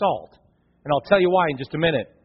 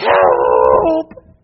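retold cry for help; 13 semitones lower, 5 dB louder than usual. Son retold calling out.

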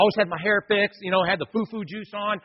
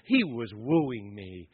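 tender; 9 semitones lower, 7 dB quieter, much slower than usual. Real, not acted. Tender memory of being wooed.

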